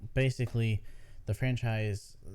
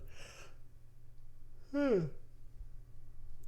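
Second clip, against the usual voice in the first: big tired yawn; long yawn; 7 dB quieter than usual. Real, not acted. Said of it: "I swear I'm yawning through half of this".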